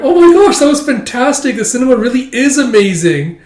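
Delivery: hammed-up movie fan; gushing excitement. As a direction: rave like a thrilled kid. Gushing girl after movie.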